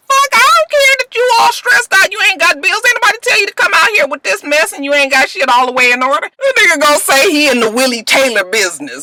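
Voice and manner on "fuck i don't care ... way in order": sassy retort. Retold or imitated. Commentator mocking Willie's emergency, high and sassy. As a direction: tell him off with attitude. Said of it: "I was just like".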